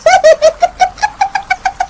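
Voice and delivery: very high long cackle; mocking witch cackle. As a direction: cackle with scorn. Witch mocks villagers' wager.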